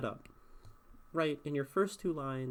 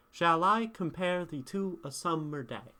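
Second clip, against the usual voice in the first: higher recited verse, weak-strong beat on "Shall I compare thee"; iambic pentameter; 4 semitones higher, 4 dB louder than usual. Shown as a demonstration. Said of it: "is iambic pentameter".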